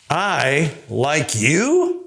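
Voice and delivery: "I like you?" very slow, rising on "you"; slow question. Shown as a demonstration. Teacher showing "I like you" as an unsure slow question. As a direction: draw it out into a doubtful question. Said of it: "I've said it three different ways".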